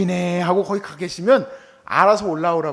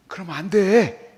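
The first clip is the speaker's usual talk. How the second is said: ordinary talk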